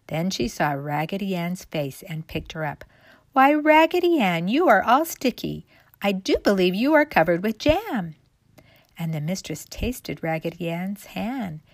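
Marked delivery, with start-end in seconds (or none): 3.3-8.1 high, loud exclamation easing off; surprised discovery. A narrator's reading. Mistress picking up sticky Raggedy Ann, surprised exclamation. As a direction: exclaim loudly in surprise at the doll.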